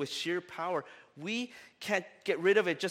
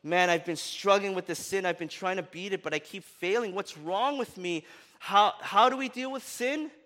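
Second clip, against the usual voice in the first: a hint of Paul's frustration, rising to a louder, higher cry at the end; frustrated Paul; 5 semitones higher, 5 dB louder than usual. Preacher voicing Paul's frustration to the congregation, louder and higher by the end. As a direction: voice a struggling man who builds to a plea. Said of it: "he's frustrated".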